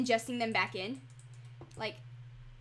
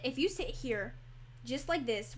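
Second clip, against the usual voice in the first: ordinary talk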